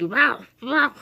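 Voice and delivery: two short barks, like a worked-up puppy; imitated dog bark. Dog barking at dad's lawnmower, imitated bark. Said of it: "and my dog was like".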